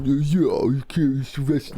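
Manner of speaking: failed action-star impression; badly faked tough-guy voice; Stallone. Shown as a demonstration. Co-host attempting the impression, badly faked tough guy. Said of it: "I can't really do it".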